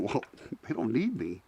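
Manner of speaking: low, hushed thought to oneself; doubting inner voice. Retold or imitated. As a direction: drop down small and soft, voicing private doubt. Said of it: "in your gut going".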